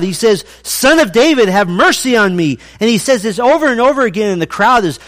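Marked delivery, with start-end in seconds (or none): 0.6-2.6 high, loud, urgent begging; a blind beggar yelling for mercy; loud pleading cry. Acted. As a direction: cry out for mercy over a crowd. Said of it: "who's aggressive and he's loud".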